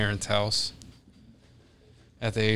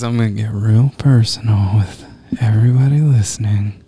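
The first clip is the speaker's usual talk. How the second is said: mock intimate address; 7 dB louder than usual. Mock intimate address, mic turned up.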